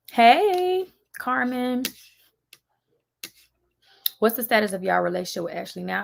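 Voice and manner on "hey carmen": calling out. Real, not acted. Calls out to Carmen.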